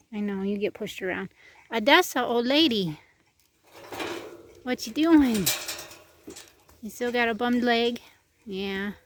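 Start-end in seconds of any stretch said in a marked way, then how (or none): 1.7-8.0 pet talk. Real, not acted. Cooing at an old horse.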